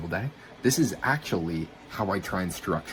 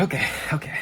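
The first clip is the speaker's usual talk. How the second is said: pitched up, nervous agreement; high nervous okay; 9 semitones higher, 3 dB louder, faster than usual. Retold or imitated. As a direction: blurt it out high and uneasy. Agreeing to a brutal workout.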